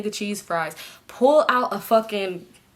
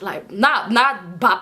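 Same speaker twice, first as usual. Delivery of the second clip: usual speaking voice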